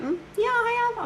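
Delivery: very high-pitched sing-out answer; coy quoted reply. Retold or imitated. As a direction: answer way up high, mock innocent. Acts her reply to friends.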